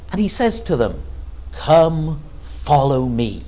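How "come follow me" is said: measured command, each word set apart; deliberate, authoritative call; great authority. Retold or imitated. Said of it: "He speaks with a voice of great authority".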